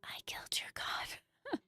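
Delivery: breathy menacing whisper in the ear. Retold or imitated. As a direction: whisper it right in their face. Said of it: "just get in their face and whispered".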